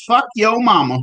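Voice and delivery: high-pitched joking yell; mock scream. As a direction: holler it high, as a joke. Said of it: "I'm just gonna be screaming".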